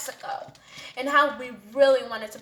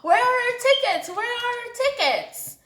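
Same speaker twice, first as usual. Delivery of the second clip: high, loud yelling at a friend; screaming demand; 11 semitones higher, 5 dB louder than usual. Retold or imitated. Friends screaming at the girl for their tickets. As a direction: shout for the missing tickets. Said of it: "started screaming".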